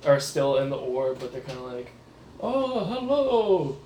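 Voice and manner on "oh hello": startled cultist. Acted. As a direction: play a cultist caught mid-ritual, thrown off. GM voices a busy cultist.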